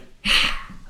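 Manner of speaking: loud, harsh voiceless rasp; imagined eagle cry. Acted. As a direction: make up a rough, toneless bird noise. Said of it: "I wish eagles went".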